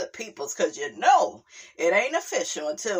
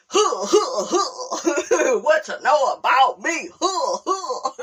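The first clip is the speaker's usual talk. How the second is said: imitating [name]; 5 semitones higher, 4 dB louder, slower than usual. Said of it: "I'ma be like".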